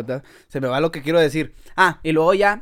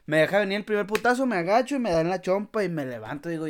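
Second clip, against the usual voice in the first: ordinary talk, nothing marked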